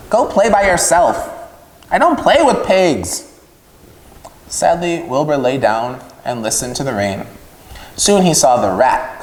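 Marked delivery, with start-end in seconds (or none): 0.1-3.2 higher, dismissive lamb voice; shooing the pig away; snooty lamb. Acted. Lamb sending Wilbur off, higher and dismissive.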